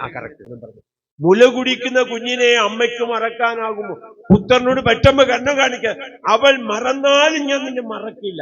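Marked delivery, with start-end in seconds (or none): 1.2-8.4 louder, higher scripture proclamation; preacher proclaiming the verse to the congregation; preaching at full force. Acted.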